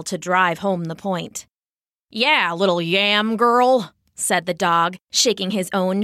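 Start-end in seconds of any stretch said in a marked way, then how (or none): 2.1-3.9 slow, louder jeering dog voice; mild mocking dog; dog taunt. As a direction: rub it in.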